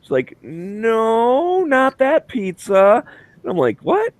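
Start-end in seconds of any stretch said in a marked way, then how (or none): 0.4-3.0 much higher, very slow correction; gently steer him off; patient agent voice. Retold or imitated. 3.8-4.1 squeaked very high disbelieving what; mock disbelief; baffled what. Baffled at the answer.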